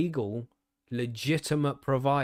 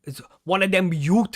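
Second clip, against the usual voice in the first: London street slang voice, louder; mock roadman, punchy and loud; roadman accent; 4 semitones higher, 8 dB louder than usual. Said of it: "talking like a roadman".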